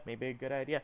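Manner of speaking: very soft, low aside; muttered interjection. Acted. Underling chimes in under the boss's speech, muttered. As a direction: slip it in quietly underneath.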